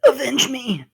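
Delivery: high, hammed-up dying cry; dramatic plea. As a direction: cry out for vengeance. Fallen character's dramatic plea.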